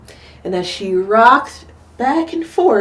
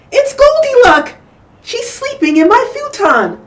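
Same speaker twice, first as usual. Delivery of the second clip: put-on excited child; 5 dB louder than usual. Child discovering Goldilocks.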